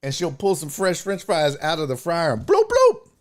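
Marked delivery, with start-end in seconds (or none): 2.4-3.1 high-pitched mimicked plop sound; imitated bloop. Comedian imitating fries coming out of the fryer, playful bloops. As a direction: make high cartoonish bloops.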